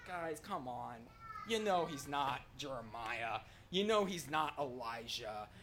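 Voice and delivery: low, soft aside to the others; Peter, quietly impatient. Acted. Peter correcting the other disciples, quiet impatient aside. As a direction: grumble it under the breath. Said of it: "Peter just being, listening to all of them going".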